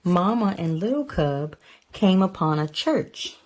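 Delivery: plain reading voice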